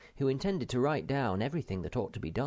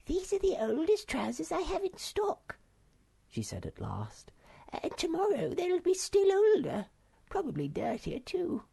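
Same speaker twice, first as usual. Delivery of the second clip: put-on little old lady. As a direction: greet the children in a bright squeaky voice.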